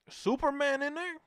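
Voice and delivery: high, loud disbelieving question; incredulous. Retold or imitated. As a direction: act out a kid's amazed double-take. Retold reaction to seeing the cape.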